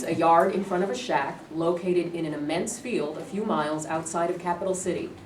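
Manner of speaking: normal talking voice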